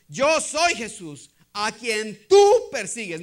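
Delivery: loud, slow pronouncement, hard weight on TÚ; emphatic divine declaration. Acted. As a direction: declare it as the Lord confronting Saul. Acts Jesus confronting Saul. (declaración solemne, fuerte y pausada)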